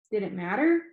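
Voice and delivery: usual speaking voice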